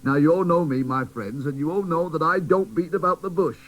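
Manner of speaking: teddy bear leader addressing the toys, firm; blunt teddy bear. Acted. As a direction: speak plainly to the crowd.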